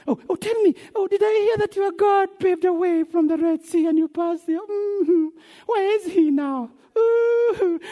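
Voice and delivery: high, put-on taunting voice; mocking onlookers. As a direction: needle them in a high, drawn-out mock wonder. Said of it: "These people are mocking us".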